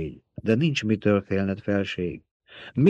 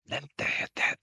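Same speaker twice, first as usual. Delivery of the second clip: breathy unvoiced whisper; 9 dB quieter than usual. A narrator's reading. The old Truthsayer pleads with Paul in a whisper. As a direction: barely breathe the words. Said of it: "suttogta az öreg asszony".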